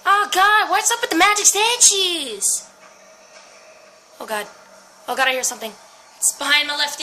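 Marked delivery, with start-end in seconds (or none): 0.1-2.7 high, loud exasperated panic; alarmed yelling. Real, not acted. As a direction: yell in alarm at another scare. Frightened again by statues.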